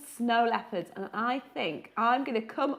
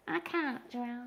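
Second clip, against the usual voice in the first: softer, a hint of a discouraged pupil; glum "I can't draw"; 8 dB quieter, slower than usual. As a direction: lightly mimic someone giving up. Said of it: "sometimes people say to me".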